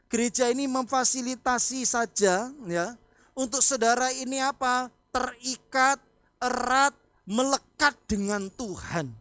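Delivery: preaching emphasis. Acted. Pastor urging the congregation to cling to God, slow and loud. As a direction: drag out each word to drive it home.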